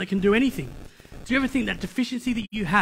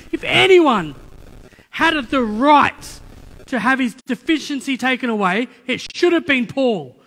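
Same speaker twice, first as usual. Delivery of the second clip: raised, emphatic preacher's protest; worked-up, a bit upset; 3 semitones higher, 5 dB louder, slower than usual. Real, not acted. Preacher upset with God on Paul's behalf, emphatic protest. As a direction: lean hard on each phrase, high and indignant. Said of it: "I say it like that because I'm still a little bit upset".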